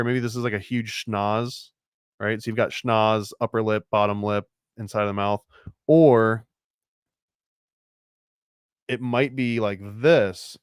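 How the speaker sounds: ordinary talk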